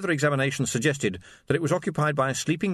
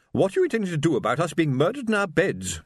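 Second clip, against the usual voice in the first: high, imperious lady's voice; indignant Mrs Rutherford, higher and haughty; demanding matron; 6 semitones higher than usual. A narrator's reading. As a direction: look down on him and demand an answer. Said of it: "she demanded".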